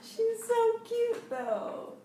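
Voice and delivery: high, drawn-out gushing over a cat; cooing. Real, not acted. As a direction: coo over an adorable pet.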